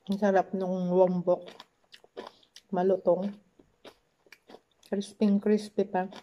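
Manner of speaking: talking between bites; chewing while talking. Real, not acted. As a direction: mouth partly full. Seen 2: Bites kimchi at -2, jaw and lips keep chewing while speaking.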